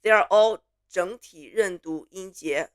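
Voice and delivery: normal talking voice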